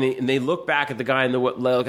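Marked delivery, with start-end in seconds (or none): none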